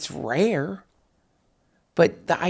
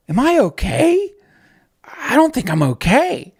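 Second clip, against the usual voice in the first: high, loud anxious question; hammed-up cry of self-doubt; mock panic; 8 semitones higher, 6 dB louder than usual. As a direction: voice pitched way up in fake alarm. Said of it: "you gotta think to yourself".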